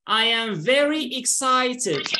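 slow, measured delivery for repeating; drawn-out teaching model; slow model phrase. Shown as a demonstration. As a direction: say it slowly for learners to copy. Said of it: "repeat this expression".

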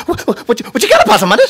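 high, loud, jittery hyper critter voice; hyper Nutria. Acted. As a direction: bounce off the walls like a sugared-up nutria. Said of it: "Nutria should have a really hyper voice".

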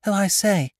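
put-on bright young girl; schoolgirl. A narrator's reading. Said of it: "she said".

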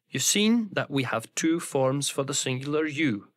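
normal talking voice

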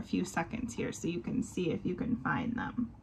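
whispered aside. Real, not acted. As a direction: drop to a secret whisper. Viewer game aside.

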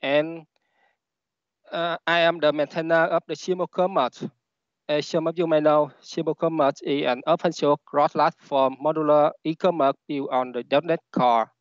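Vietnamese-accented English; non-native English with a Vietnamese accent. Real, not acted. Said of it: "English is not my first language".

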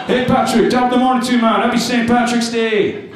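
loud, high Irish greeting sliding into Jamaican; botched Irish-Jamaican accent. Acted. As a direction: call it out in a badly faked Irish accent that turns Jamaican. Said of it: "turns into a Jamaican accent".

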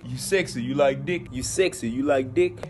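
low, soft mocking come-on; deep whispered flirt. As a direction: purr it like a creep. Mocking quote repeated softer.

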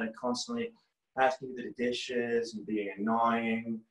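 low, slow, stretched meditation voice; Andy Puddicombe. Acted. Guiding students living with mom and dad, slow meditation impression. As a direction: lull them like a meditation app guide. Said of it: "brought to you by the voice and founder of the app Headspace".

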